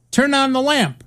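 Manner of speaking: high-pitched mock order, falling at the end; insistent user command. Presenter retries failing computer demo, insistent command.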